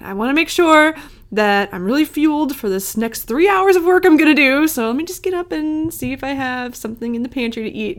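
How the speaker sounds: building excuse, rising then easing off; self-justifying inner voice. Acted. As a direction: talk yourself into a snack. Voicing an eager self-excuse.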